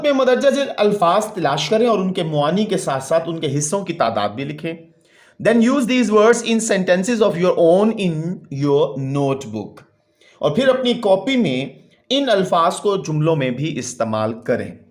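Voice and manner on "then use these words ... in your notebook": slow, deliberate textbook reading; slow read-aloud. Real, not acted. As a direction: read it out word by word for a class. (ٹھہر ٹھہر کر سبق پڑھنا)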